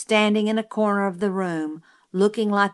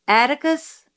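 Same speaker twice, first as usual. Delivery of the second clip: higher-pitched call down the hall; raised, questioning call; distant call; 8 semitones higher, 3 dB louder than usual. A narrator's reading. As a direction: call out for someone in another room. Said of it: "His voice was distant".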